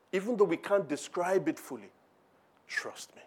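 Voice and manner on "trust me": soft whispered "Trust me". Acted. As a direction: lean in and whisper it like a secret. Repeated whispered reassurance.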